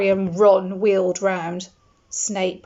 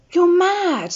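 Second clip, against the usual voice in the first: aghast; 10 semitones higher, 5 dB louder than usual. A narrator's reading. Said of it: "said Ron".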